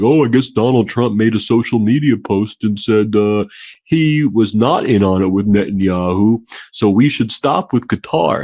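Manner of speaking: sarcastic put-on voice of Iran's leadership; mock Iranian leaders. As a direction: play a gullible regime taking a post at face value. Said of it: "you think Iran's gonna be like".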